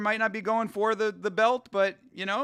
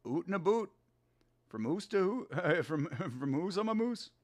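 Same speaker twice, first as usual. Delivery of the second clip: softer exaggerated Canadian "oot and a boot"; mock Canadian accent; 6 dB quieter than usual. Streamer teases Hydra with a softer mock Canadian accent. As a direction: ham up the Canadian vowels. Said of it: "He doesn't even have an accent".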